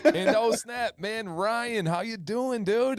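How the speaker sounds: high, loud, thrilled hello; excited greeting. Real, not acted. Host welcoming a remote guest, a high excited hello.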